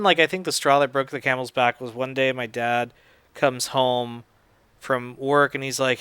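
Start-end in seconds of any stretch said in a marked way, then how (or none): none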